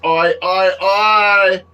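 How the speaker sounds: loud, high hammed-up Australian yelling; mock Australian shout. Joking about his Australian shirt, loud Australian yell. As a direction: shout it like a cartoon Aussie bloke. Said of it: "I'm wearing my Australian t-shirt".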